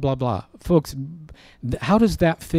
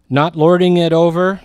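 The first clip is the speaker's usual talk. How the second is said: emphatic declaration; 5 semitones higher, 8 dB louder than usual. Real, not acted. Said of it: "preacher emphasis".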